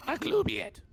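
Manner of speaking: high, loud goblin voice; squeaky shouting goblin. Acted. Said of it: "can I say this in the goblin voice".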